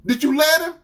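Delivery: loud, high accusing question; outraged boyfriend. Retold or imitated. Boyfriend accusing his girlfriend, outraged. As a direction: demand it in rising outrage. Boyfriend interrogates her angrily.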